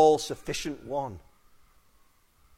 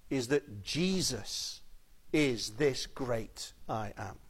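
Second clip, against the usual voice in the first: slow, lowered emphasis; 5 semitones lower, 7 dB quieter, slower than usual. Real, not acted. Preacher slows on I Am.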